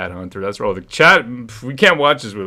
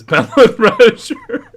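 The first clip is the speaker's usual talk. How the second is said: high slow laughing; 7 dB louder, slower than usual. Real, not acted. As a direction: laugh through the name. Laughing at chat.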